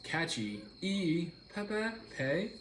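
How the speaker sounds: CATCHY slowly, then a high separate E, then PEPE ending in PAY; sounded-out pronunciation. Shown as a demonstration. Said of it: "Oh, it's pronounced".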